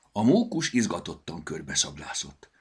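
ordinary delivery

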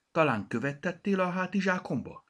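put-on high, whining little girl complaining; whiny child. Bogi panaszkodik anyjának. (nyafogó kislány)

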